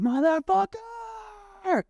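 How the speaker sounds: high, long drawn-out yell; retold scream. Man retelling yelling into Bali night for neighbors to hear, drawn-out high scream. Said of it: "I screamed in the night".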